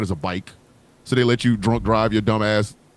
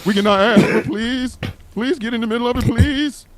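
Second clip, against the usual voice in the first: higher, whiny begging, rising each please; mock pleading Southern states; 9 semitones higher than usual. Comedian voicing the South begging the world, mock pleading. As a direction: beg in a hammed-up higher voice. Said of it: "the Southern station's like".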